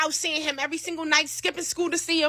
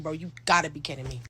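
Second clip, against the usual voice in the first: low, soft muttering; low soft exasperation; muttered disbelief; 9 semitones lower, 7 dB quieter than usual. Retold or imitated. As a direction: mutter it under your breath. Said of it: "I'm like".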